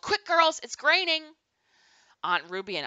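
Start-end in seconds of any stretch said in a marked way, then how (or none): 0.0-1.2 high, loud urgent call; excited summons, pitched way up and projected. A narrator's reading. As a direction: call the girls in from the next room. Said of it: "Grandma called".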